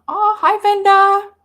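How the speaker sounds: high, loud hello to a friend; bright squeal of welcome; delighted greeting. Real, not acted. Greets a viewer arriving in chat.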